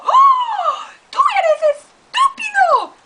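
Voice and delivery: very high, loud shriek; shrieking cat. Acted. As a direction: scream the insult. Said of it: "this is what it would sound like".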